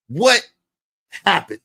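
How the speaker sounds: ordinary delivery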